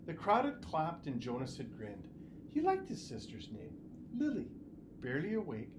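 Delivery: usual speaking voice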